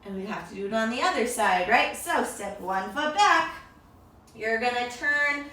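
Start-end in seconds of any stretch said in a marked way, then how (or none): none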